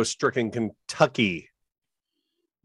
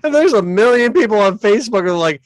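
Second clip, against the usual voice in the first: laughing; 11 semitones higher, 6 dB louder than usual. Retold or imitated. Mocking gullible Facebook users.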